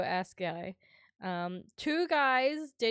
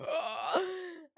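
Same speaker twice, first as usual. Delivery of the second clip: high astonished gasp; imitated wide-mouthed gasp; mock gasp; 7 semitones higher than usual. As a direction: gasp in awe at the show. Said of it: "He was like".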